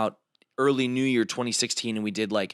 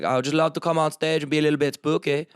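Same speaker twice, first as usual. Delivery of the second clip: high, loud, put-on vampire voice; hammed-up shy vampire asking to go on; [name]'s vampire voice; 5 semitones higher, 3 dB louder than usual. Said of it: "he plays a vampire in our shows".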